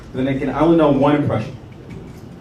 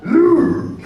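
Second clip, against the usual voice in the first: high-pitched, croaky little Jedi master; 13 semitones higher, much slower than usual. Acted. Jedi master urging Luke to smoke, comic Yoda voice. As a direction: do a silly Yoda voice, high and strained.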